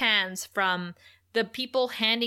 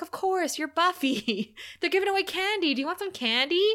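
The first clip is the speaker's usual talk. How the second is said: put-on high, bubbly childish voice, partly laughing; Joyce, regressed to a child, offering her daughter candy in a high giddy voice; giddy kid; 8 semitones higher than usual. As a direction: sound like a sugar-high little girl.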